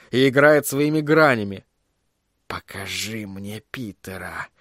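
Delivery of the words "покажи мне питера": low, slow, hushed command; whispering. A narrator's reading. Sorcerer commanding his crystal to show Peter, whispering. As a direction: breathe the order very softly and slowly. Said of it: "прошептал он".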